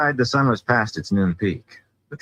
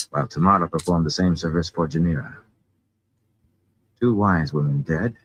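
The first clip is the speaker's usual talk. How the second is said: ordinary delivery, nothing marked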